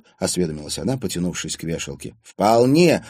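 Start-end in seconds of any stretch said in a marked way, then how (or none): 2.2-3.0 loud, higher exclamation; exclaiming. A narrator's reading. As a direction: approve with a quick burst. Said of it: "воскликнула". (восклицая)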